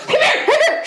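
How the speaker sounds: hollering to scare bears away; nervous hiker shouting hey bear over and over; frantic bear yell. Retold or imitated. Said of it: "you're supposed to yell".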